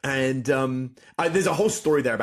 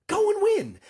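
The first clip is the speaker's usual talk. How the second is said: rousing push; 4 dB louder, slower than usual. Real, not acted. Pep talk to the listener.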